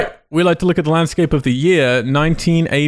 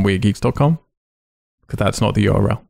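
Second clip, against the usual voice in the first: ordinary talk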